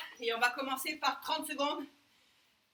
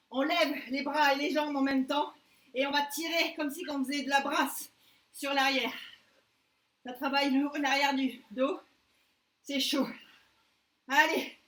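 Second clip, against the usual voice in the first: ordinary talk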